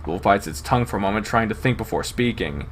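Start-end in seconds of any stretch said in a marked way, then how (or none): none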